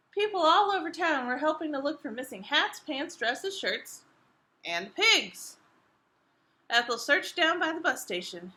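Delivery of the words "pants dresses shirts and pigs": usual speaking voice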